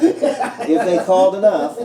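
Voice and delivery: laughing through the words. Real, not acted. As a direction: say it while laughing.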